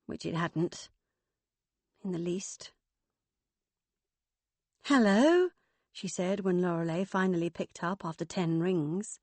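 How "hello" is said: a bright rising "Hello" on the phone; lightly voiced Megan. Megan phoning her mother, light rising hello. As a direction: greet her mother warily.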